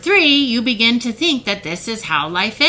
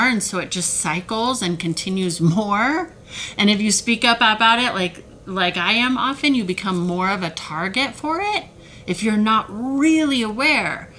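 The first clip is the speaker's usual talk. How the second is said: usual speaking voice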